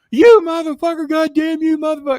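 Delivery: hammed-up screaming fit; mock furious Jimmy. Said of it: "he just goes off".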